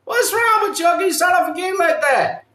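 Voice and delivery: high, shouted disbelief; high-pitched outraged shout; exasperated yell. Real, not acted. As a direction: yell it at the screen. Exasperated outburst at the game.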